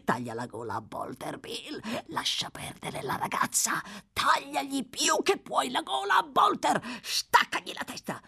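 high, hissed and shouted orders to kill; a mad old man urging murder. Acted. (delirio sussurrato e gridato)

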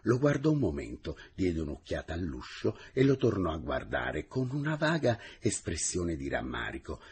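plain reading voice

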